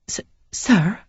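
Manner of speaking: a hint of a timid young woman, stammering; lightly voiced shy lady unsure; Sarah, hesitant young lady. A narrator's reading.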